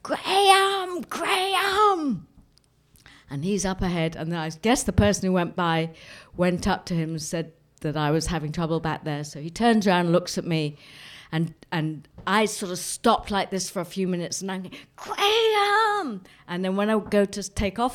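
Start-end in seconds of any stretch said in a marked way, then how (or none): 0.0-2.3 high, drawn-out wail for help; plaintive mock yell, very high-pitched; scared, tearful call. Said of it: "starting to cry because I was getting really scared". 14.9-16.2 very high, drawn-out wail; mock wail, pitched right up; plaintive call. Stranded, calling after companion.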